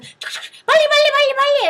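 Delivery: high, loud, rapid-fire urging; shrieky mock rush-along; hurrying ajumma. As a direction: imitate a frantic ajumma herding people. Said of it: "she grabs them and she's like".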